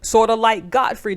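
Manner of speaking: ordinary talk, nothing marked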